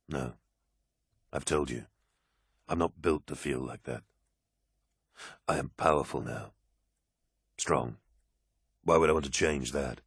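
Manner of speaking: low, teasing space marine; calm soldier, lightly amused; deep warrior voice. Acted. Loken's voice.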